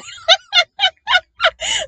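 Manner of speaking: bursting high-pitched cackle; a squealing real laugh. Real, not acted. Laughing at her own joke to the viewers, high squeal.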